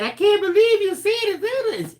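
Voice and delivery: high, furious outburst; mad mother. Retold or imitated. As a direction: scold him in shock and rage. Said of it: "got so mad".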